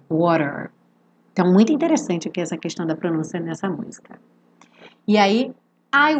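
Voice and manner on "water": WATER grave, com R final enrolado; R americano enroladinho. Shown as a demonstration. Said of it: "o R enroladinho". (WATER à americana, "uórer")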